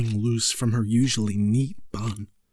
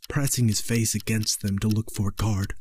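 ordinary delivery, nothing marked

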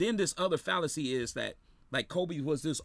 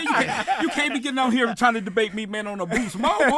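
laughing; 7 semitones higher, 9 dB louder than usual. Real, not acted. Teasing caller's bad phone.

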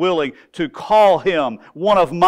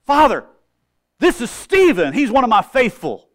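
higher, louder proud presentation; Jesus proudly introducing Stephen to the Father, raised and bright; proud introduction; 8 semitones higher, 6 dB louder than usual. Retold or imitated. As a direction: present him to the Father with pride. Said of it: "I can just hear it".

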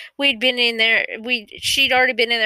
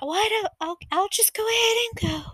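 much higher, softer, quietly giving in; imitating upset aunt; 11 semitones higher, 3 dB quieter than usual. Aunt in late seventies, hurt and giving up, higher and softer. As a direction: mimic the hurt old aunt, high and soft. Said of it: "And my aunt's like".

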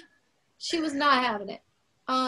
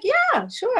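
eager yes; 10 semitones higher than usual. Retold or imitated. Eager yes to singing background.

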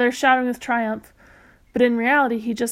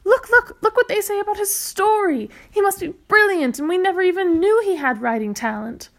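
much higher, overjoyed voice; gush, overjoyed; 8 semitones higher than usual. Acted. Mother overjoyed about story.

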